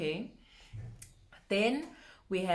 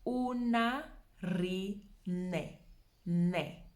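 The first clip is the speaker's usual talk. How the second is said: "Unarine" drawn out in pieces, then "ne" alone; syllable by syllable; much slower than usual. Shown as a demonstration. Teacher giving the full name, "Unarine" slowly broken up. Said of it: "But the full name".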